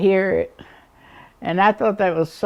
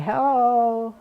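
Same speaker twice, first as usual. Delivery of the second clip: calling hello; 7 semitones higher than usual. Retold or imitated. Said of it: "I could say".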